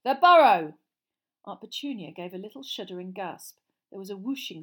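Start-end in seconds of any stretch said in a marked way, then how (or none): 0.0-0.8 loud, raised destination call. A narrator's reading. Fred stepping into the magic fire, calling out his destination. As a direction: announce the place with a big falling cry.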